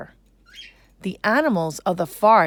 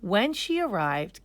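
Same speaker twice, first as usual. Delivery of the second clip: usual speaking voice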